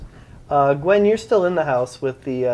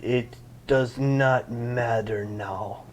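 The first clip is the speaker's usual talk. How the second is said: slow dazed villager; 3 semitones lower, 6 dB quieter, much slower than usual. Acted. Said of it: "and he goes".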